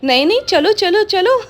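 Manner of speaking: high, loud, impatient pet voice; jealous pet urging its owner to leave, impatient whine; voicing Ammu. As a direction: whine like a jealous pet tugging you away. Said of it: "और अम्मू".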